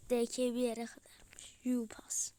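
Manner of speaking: soft unvoiced aside; whisper. Real, not acted. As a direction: breathe it out as a whisper. Fısıltıyla yorum. (fısıldayarak)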